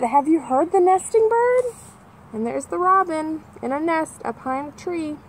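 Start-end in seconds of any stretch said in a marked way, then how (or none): 0.0-1.7 put-on much higher, childlike curious voice; kids asking. The kids in the book asking, in a childlike voice. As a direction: ask it like an eager child. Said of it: "and they said".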